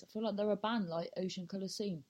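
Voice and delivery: ordinary talk, nothing marked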